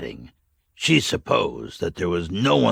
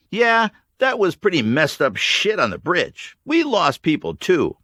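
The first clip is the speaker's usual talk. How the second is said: higher voice, weary and frank; Mags, sober; 8 semitones higher, 3 dB louder than usual. A narrator's reading. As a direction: admit the loss plainly. Mags about old man.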